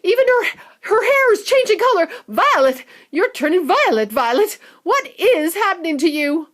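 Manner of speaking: high, loud frantic mother near tears; panicking mother. Acted. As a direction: wail at the change. Mother panicking over Violet.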